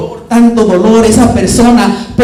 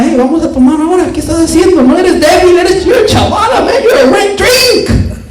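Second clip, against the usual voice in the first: higher, fast teasing buddy; taunting friend; 6 semitones higher than usual. Retold or imitated. Drinking buddy pushing him to drink, laughing taunt.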